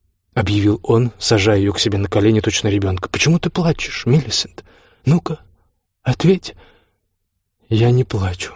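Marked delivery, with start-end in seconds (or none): 3.1-6.6 higher-pitched, prodding questions; pressing husband. A narrator's reading. Drunk husband presses his crying wife, prodding and higher. 7.7-8.5 lightly voiced meek wife; subdued denial. A narrator's reading. Wife denies crying to her bullying husband, subdued.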